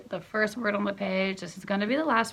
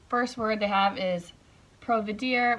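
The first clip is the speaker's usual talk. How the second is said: ordinary delivery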